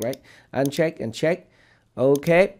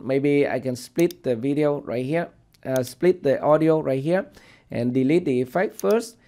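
ordinary talk, nothing marked

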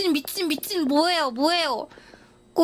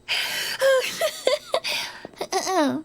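tearful laugh; 6 semitones higher than usual. Real, not acted. Crying laugh after pleading.

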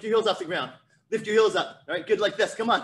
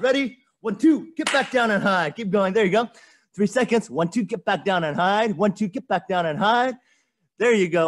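punchy, raised-voice workout commands; drill call; 3 semitones higher, 6 dB louder than usual. Real, not acted. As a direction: shout the counts and keep them moving. Calling a fast repeated punch drill.

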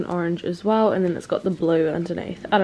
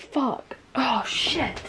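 muttered curse; 4 semitones higher, 7 dB quieter, much slower than usual. Real, not acted. After spilling water.

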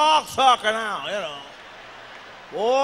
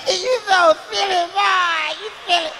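flirty woman; 6 semitones higher than usual. Retold or imitated. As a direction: play the flattered woman laughing it off. Woman giggling, flattered reply.

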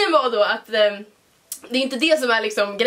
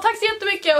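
overly polite thanks; 8 semitones higher than usual. Retold or imitated. Thanking awkwardly.

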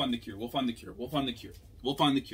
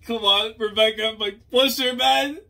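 much higher and louder, pushing; urging shout; 11 semitones higher, 12 dB louder than usual. Real, not acted. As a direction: cheer her on hard. Urging the character on.

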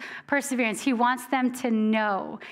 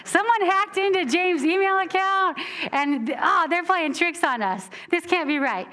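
a bit higher, alarmed reader who smells a scam; hammed-up disbelief at a strange letter; mock alarmed suspicion; 6 semitones higher than usual. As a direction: play the suspicious reader fooled by spam. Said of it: "Someone immediately said".

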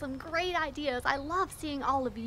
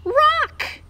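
big, slow, loud reveal; thrilled drumroll-style reveal; excited; 5 semitones higher, 13 dB louder, much slower than usual. Acted. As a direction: announce it proudly, stretching the words high and loud. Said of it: "I am so excited".

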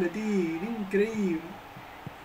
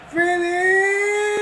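rising shout on the name; excited shout; 9 semitones higher, 8 dB louder than usual. Acted. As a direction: call the name as the shot goes. Goal chance building. (grito emocionado)

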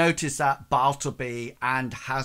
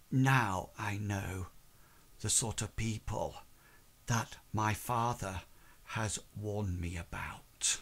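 quiet, controlled; 4 semitones lower, 7 dB quieter than usual. Acted. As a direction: hold it in with quiet, controlled disdain. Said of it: "she's quite, um, quiet, and she's not hysterical".

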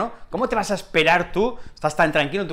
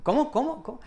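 confused listener; 7 semitones higher, 6 dB quieter, faster than usual. Pretend. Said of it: "alguien no lo entiende".

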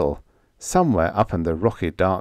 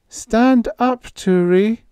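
put-on high, loud, trembling call of a girl; quavering call; 5 dB louder, slower than usual. A narrator's reading. Babs calling.

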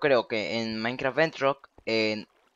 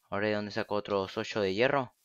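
ordinary delivery

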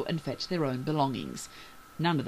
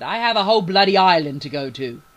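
loud, higher, indignant declaration; defiant outburst; 4 semitones higher, 12 dB louder than usual. A narrator's reading. Bailey rebuffs the reverend, loud and defiant. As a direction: throw it out loudly in defiance.

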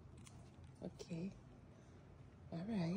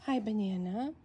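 ordinary talk

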